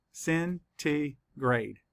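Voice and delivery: slow, each syllable pulled apart. Shown as a demonstration. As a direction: sound out each syllable slowly. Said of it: "count the syllables".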